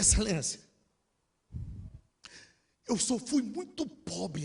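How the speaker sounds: drawn-out, emotional confession; retold tearful plea. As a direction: plead as if breaking down. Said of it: "Aí eu já desabei a chorar".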